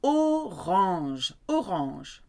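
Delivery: orange said the French way, oh-RAHNZH, slowly modelled; French accent. Shown as a demonstration. Teacher models the colour for learners, orange in French accent. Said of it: "but you need your French accent".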